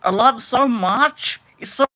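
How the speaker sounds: slow, mocking attempt at an Australian accent; botched Australian accent. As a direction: gush in a badly faked Australian accent. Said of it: "I don't know what accent that was supposed to be".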